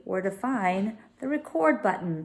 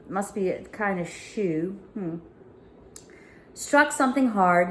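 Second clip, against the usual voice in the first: ordinary delivery, nothing marked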